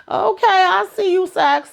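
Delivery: high, teasing shout-out; playful praise. Real, not acted. Cheering a character on.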